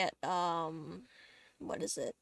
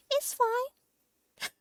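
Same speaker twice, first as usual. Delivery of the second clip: strained high laugh; 13 semitones higher, 4 dB louder than usual. Real, not acted. Laughing off the mistake.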